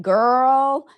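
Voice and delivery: put-on American "girl" accent. Showing a room service girl the US accent, exaggerated American drawl. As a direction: lay on the American accent, stretching the word. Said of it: "so I go like this".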